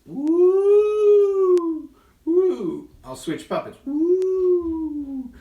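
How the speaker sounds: high, loud happy whoop; excited woo. Real, not acted. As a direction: whoop with delight. Excited shadow effects.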